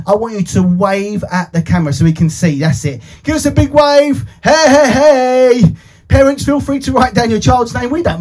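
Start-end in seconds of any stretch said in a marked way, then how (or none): none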